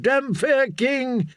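high, slow chant of acclaim; drawn-out calls, pitched well up; crowd cry. A narrator's reading. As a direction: chant it as a clamouring congregation. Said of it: "they cried".

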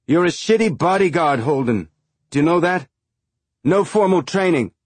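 loud, high shouting over ringing ears. Acted. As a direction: yell it as if half-deaf after gunfire. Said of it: "Holden shouted back".

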